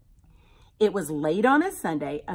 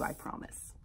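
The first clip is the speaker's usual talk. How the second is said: soft whispered promise; 16 dB quieter than usual. Real, not acted.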